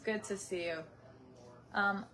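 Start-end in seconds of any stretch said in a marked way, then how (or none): 0.0-0.8 softer put-on TV host voice; a quieter, polished TV greeting; TV presenter voice. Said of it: "I'm trying to talk like the people on T V".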